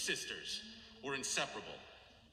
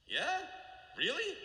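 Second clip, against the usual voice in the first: much higher, incredulous; disbelieving question; 12 semitones higher, slower than usual. Retold or imitated. Ask it as if you can't believe her, pitched far up. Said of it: "questioned her".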